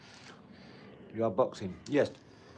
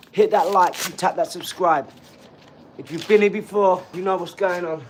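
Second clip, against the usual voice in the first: hyped shout; 4 semitones higher, 10 dB louder than usual. Real, not acted. Hype outro to viewers.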